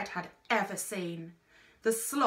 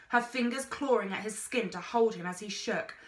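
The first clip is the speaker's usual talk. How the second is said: plain reading voice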